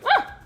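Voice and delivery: sudden high yelp of surprise; startled shriek. Real, not acted. Startled by the blender.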